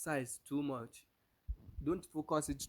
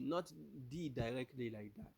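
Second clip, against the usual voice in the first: normal talking voice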